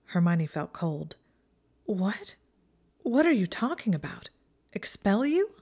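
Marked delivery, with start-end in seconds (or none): 1.8-5.5 higher-pitched, incredulous questions; bewildered, voice rising sharply; startled disbelief. Acted. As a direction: ask it taken aback, pitch jumping up. Told of an expulsion threat, stunned.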